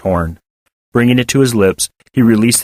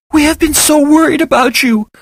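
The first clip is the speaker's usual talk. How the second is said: tearful; 3 dB louder than usual. Acted. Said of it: "in a tearful voice".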